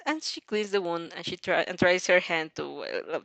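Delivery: plain reading voice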